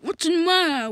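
usual speaking voice

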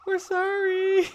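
very high, slow pleading kitten voice; high kitty voice. Pretend. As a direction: squeak out a tiny apology.

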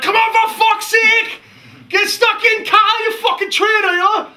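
very high, full-volume frenzied yelling; hammed-up berserk supporter, shouting at the top; snuff-fuelled fan shout. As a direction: scream abuse from the terrace as if wired. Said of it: "once the snuff went in my nose".

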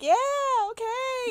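high, uncertain little okay; confused agreement. Retold or imitated. Bewildered idol in the back, confused agreement. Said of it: "in the back so confused".

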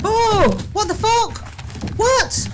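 sharp high startled cry. Real, not acted. Viewer startled by ghost video, high yelp. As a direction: jump at what you just saw.

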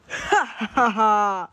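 slow booming evil monster laugh. Acted. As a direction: cackle slowly like a beast revealing itself. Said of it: "it's a monster".

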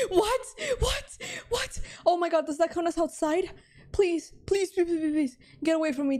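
high, startled yelps into frantic pleading; high-pitched panic; panicked. Real, not acted. Panics at a scare in game.

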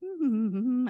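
bright little laugh; short laugh. Real, not acted. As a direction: laugh at yourself. Laughing at own joke.

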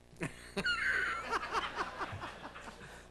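a high whistling laugh that squeaks up very high. Real, not acted. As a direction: let the laugh break into a whistle. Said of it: "You kinda whistled there when you laughed".

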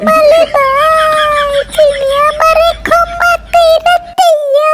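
very high-pitched wailing wife voice; lamenting wife. Acted. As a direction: complain in a high put-on voice, stretching the phrase ends. Wife lamenting her lazy husband.

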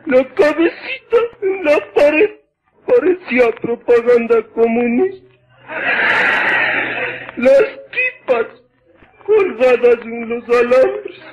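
high, crying wail, hammed up; mock wailing neighbour. Neighbour wails to neighbour about her husband, mock sobbing. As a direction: sob out the tale for laughs.

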